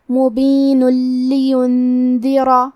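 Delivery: مبین لینذرا joined, the ن merged into a doubled ل with no nasal hum, very slow; idgham without ghunna. Shown as a demonstration. Teacher demonstrating idgham without ghunna to students, clean slow joining. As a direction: slide مبین straight into لینذرا with no humming. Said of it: "لام میں ملا کے بغیر غنہ".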